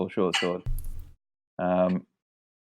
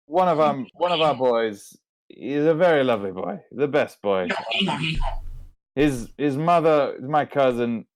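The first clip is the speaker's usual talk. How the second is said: put-on light French accent, pleading family duty in a higher voice; French merchant voice; 5 semitones higher, 4 dB louder than usual. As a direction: lay on the French charm while haggling. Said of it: "it's just getting more French now".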